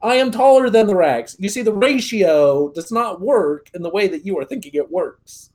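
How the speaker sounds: loud pedantic lecture; condescending explanation. Retold or imitated. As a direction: explain it smugly. Lecturing about racks.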